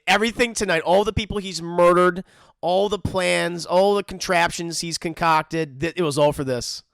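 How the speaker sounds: ordinary talk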